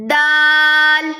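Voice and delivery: دال held long and sung, ending on a clear ل; chanted دال; drawn-out letter name. Shown as a demonstration. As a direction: stretch دال slowly with a ringing ل. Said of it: "آخر میں لام کی ساؤنڈ آئے گی".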